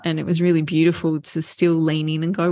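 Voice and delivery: usual speaking voice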